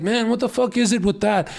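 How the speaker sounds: frustrated outburst. Retold or imitated. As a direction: throw up your hands at the unfairness. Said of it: "And I was like".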